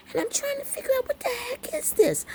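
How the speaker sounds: squeaky, very high bafflement; high-pitched voice. Retold or imitated. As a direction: shoot up into a high, puzzled squeal. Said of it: "I got my high-pitched voice going on".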